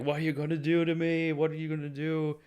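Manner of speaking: high-pitched imitated panic; high, drawn-up frightened voice, retold; freaking out. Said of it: "he's just freaking out".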